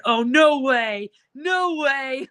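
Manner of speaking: long, rising mock cheer of disbelief; obnoxious cheering. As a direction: imitate your own obnoxious sideline yell, stretching each word. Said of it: "you hear my obnoxious ass going".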